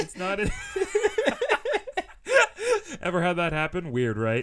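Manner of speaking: talking through laughter; loud laughter breaking the words; laughing. Real, not acted. Laughing fit.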